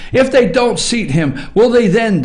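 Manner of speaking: usual speaking voice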